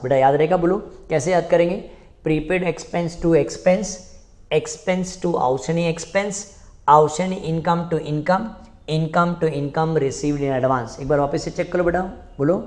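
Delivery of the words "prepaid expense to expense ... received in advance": slow, evenly chanted entries; slow chanted memory drill; slow recitation drill. Shown as a demonstration. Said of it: "recites four entries as drill".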